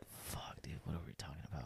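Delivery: hushed, whispered aside; retold whisper. Retelling his reaction to her listening, whispered. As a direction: whisper it low, as if privately alarmed.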